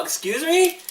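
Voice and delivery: high rising question, put on; mock bewilderment. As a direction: act taken aback by an intruder. Mimics victim's stunned reaction.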